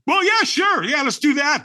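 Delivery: high, put-on enthusiasm; mock eagerness. Man thinking about watching others firewalk, mock eager. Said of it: "And I'm thinking".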